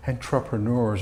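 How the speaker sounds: flat American gringo pronunciation; gringo accent. Shown as a demonstration. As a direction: say entrepreneurs like a gringo. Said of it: "very gringo".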